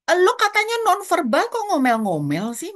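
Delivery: high-pitched complaining retort; mock indignant protest. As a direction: protest as if calling out a hypocrite. Imagined protest in own head.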